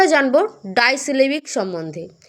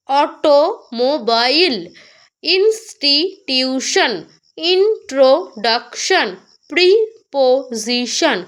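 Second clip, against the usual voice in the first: Automobile, institution, introduction, preposition slowly broken into four or more parts; syllable by syllable; much slower than usual. Shown as a demonstration. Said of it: "চার বা তার বেশি সময় থেমেছি".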